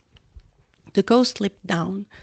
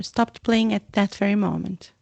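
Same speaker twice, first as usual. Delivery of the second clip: ordinary delivery, nothing marked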